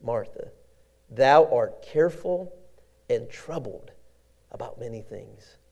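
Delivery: Jesus softly correcting a worried Martha; tender rebuke. Acted. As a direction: comfort her gently, letting it fade. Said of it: "Jesus said unto her".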